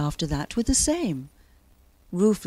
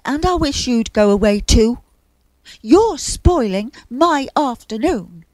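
louder, higher, fed-up grumble; cross complaint; 5 semitones higher, 8 dB louder than usual. A narrator's reading. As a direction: tell them off irritably. Bear crossly complains to Wind.

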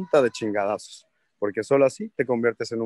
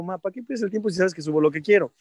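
fast, higher back-talk to mom; defiant son; 5 semitones higher, faster than usual. Retold or imitated. Son answering his nagging mom, defiant. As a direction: brush her off quickly. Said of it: "Y yo digo".

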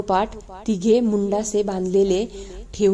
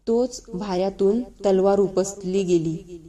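ordinary delivery

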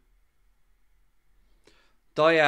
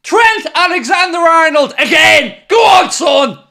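much higher, much louder roaring celebration; ecstatic screaming; 14 semitones higher, 12 dB louder, much slower than usual. Real, not acted. Fan screams in delight as Trent scores the winner. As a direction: scream the place down with joy.

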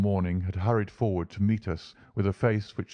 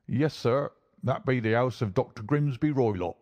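higher-pitched country driver character; a local driver answering a gentleman; 4 semitones higher than usual. A narrator's reading. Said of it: "remarked the driver".

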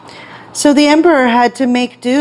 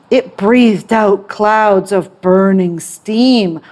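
usual speaking voice

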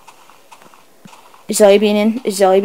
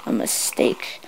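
whispered regret; 9 dB quieter, slower than usual. Real, not acted. Regretting attack, trailing whisper.

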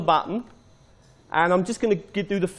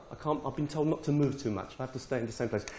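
softer, lower, quicker aside; quiet aside; 5 semitones lower, 9 dB quieter, much faster than usual. Real, not acted. Presenter told not to move, muttered aside. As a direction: drop the voice and hurry through it.

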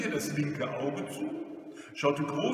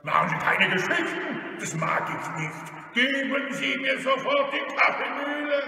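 loud, raised robber voice; bully the victim into obeying; rough, insistent demand; threatening robber; 10 semitones higher, 6 dB louder than usual. Acted. Robber demands the coffee mill.

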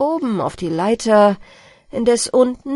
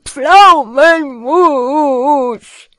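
high, wavering, drawn-out wail; hammed-up full-volume howl; very loud yell; 4 semitones higher, 11 dB louder, much slower than usual. A narrator's reading. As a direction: bellow it in long, quavering vowels. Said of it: "schrie der Peter ganz laut".

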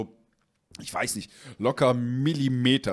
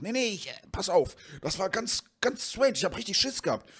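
high, sheepish voice of the buddy; nervous friend; 8 semitones higher than usual. Retold or imitated. Friend telling the owner about the broken game, high and flustered. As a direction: play the flustered friend, pitched up.

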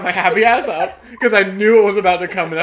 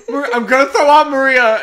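loud, higher, urgent re-enacted cry; calling for help; 6 semitones higher, 9 dB louder than usual. Waking a sleeping friend before vomiting, calling for help. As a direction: cry out fast to rouse a sleeper. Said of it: "calling for help".

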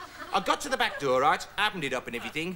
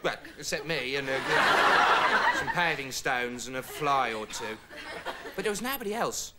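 ordinary talk

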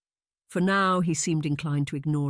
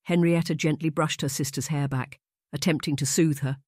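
ordinary delivery

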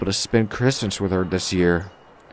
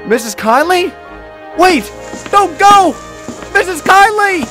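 high, loud shouts, building from a question; desperate calling out; 6 dB louder than usual. A narrator's reading. Thomas calls after the departing woman, desperately. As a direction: cry after her, high and increasingly loud.

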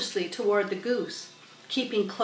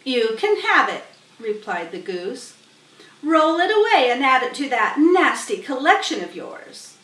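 loud scornful goose; goose's voice; 4 semitones higher, 6 dB louder than usual. Acted. Goose dismissing Templeton with disgust. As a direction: brush the rat off with disgust.

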